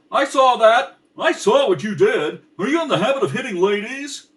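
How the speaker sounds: high, loud blustering accusation; indignant challenge. Acted. George confronting the tramp, loud high indignation. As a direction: bluster at the man who pushed her.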